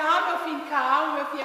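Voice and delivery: loud mock-tearful pleading; lightly acted tearful parent consoling a son; família chorosa. Retells tearful family seeing son off. (imitando um parente choroso, em voz alta)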